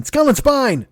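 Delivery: high strained cry of pain. Real, not acted. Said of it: "the fucking shooting pain".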